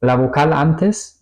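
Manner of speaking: ordinary delivery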